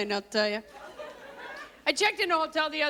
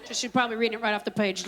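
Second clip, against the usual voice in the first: ordinary delivery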